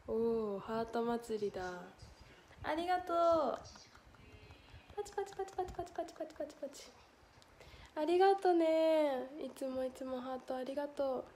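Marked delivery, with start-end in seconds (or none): 5.0-6.8 高く小さな早口のパチパチ; 刻むように拍手音を口でまねる; 口拍手. Pretend. 口で拍手の音をまねる. (小声で素早く拍手のまね)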